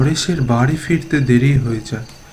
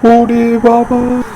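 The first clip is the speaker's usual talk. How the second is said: much higher and louder, slowed, panicked cry; terrified shout; 8 semitones higher, 10 dB louder, slower than usual. Acted. Paresh sees dead Raghu under the tree, terrified shout. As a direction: scream it at a ghost. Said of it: "Paresh ভয়ে".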